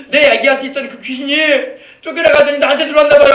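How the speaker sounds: high, loud frantic cry; acted alarm. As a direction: blurt it out as a frightened mother would. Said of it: "막 놀래가지고 왔다는 거예요". (놀란 엄마가 높고 크게 호들갑 떠는 흉내)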